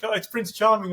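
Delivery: high, gushing fan voice; excited gushing. Retold or imitated. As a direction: gush like a starstruck fan. Ladies gush over Prince Charming.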